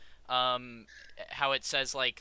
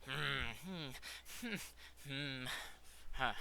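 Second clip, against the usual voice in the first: anxious humming; 9 dB quieter, much slower than usual. Acted. Frazzled scientist hurrying down the hall, anxious hums. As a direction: mutter wordless little hums to yourself, softly. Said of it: "he is going".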